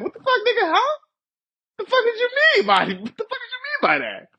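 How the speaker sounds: high, squeaky mock outrage; touchy overreaction. Acts out touchy man's overreaction.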